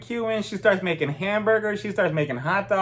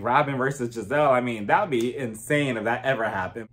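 ordinary talk

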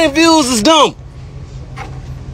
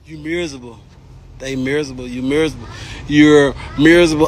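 low mutter; 9 semitones lower, 5 dB quieter, faster than usual. Real, not acted. Quiet low repetition.

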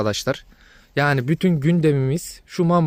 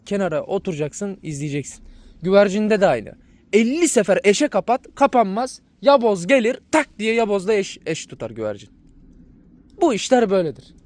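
normal talking voice

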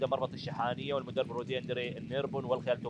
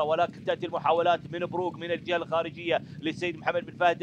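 rising, louder call as horses battle; excited race call; 4 semitones higher, 5 dB louder than usual. Real, not acted. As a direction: build the excitement to the finish. Race climax, horses battling for lead.

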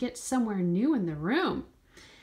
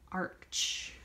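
whisper; 3 semitones lower, 7 dB quieter than usual. Pretend. Whispered Halloween cat arch.

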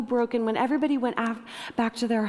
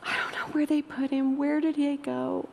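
higher, tearful lost questioning; heartbroken; 5 semitones higher than usual. Acted. Mary asking the angels where he went, subdued heartbreak. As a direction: plead gently, holding back the crying. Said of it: "her heart is broken, and she's crying".

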